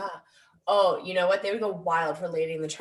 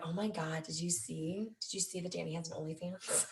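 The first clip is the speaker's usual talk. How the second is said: much softer, conspiratorial mock gossip; hushed gossip; 12 dB quieter than usual. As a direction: pass it on like a scandalous secret. Acts out people gossiping about her.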